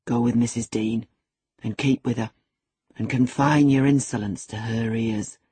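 low, controlled menace; forced calm over simmering anger; assumed calmness. A narrator's reading. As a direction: keep the threat low and measured. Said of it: "said with assumed calmness".

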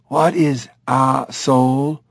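slow, puzzled chimp voice. Acted. Dave mishears Lynn, slow puzzled chimp voice. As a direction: innocent chimp asking, slowly.

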